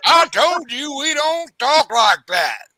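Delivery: slow, high, loud mock reprimand; scolding daddy. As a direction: imitate a father laying down the law to his son. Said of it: "his daddy looked at him and go".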